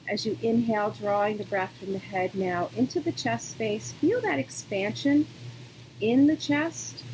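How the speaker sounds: calm, unhurried yoga-teacher voice with long pauses; guided meditation. Real, not acted. Yoga teacher settling students into breathing, calm guidance.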